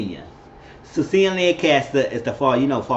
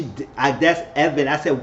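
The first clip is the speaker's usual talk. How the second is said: ordinary delivery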